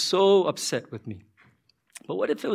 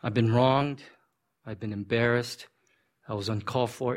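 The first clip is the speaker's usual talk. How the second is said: stunned, fazed; 8 semitones lower, 4 dB quieter than usual. Acted. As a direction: voice a wronged man's dazed, hushed thoughts. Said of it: "just kinda stunned. He's kinda fazed".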